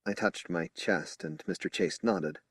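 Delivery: usual speaking voice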